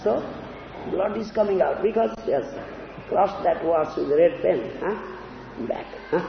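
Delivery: usual speaking voice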